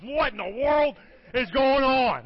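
loud, high, drawn-out cry of alarm; mock-panicked shout, stretching the words; freaking out. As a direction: holler it like a startled preacher. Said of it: "freaking out".

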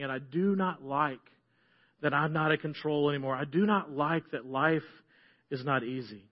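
mournful prayer to God; lament prayer. Acted. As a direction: pray it out low and heavy.

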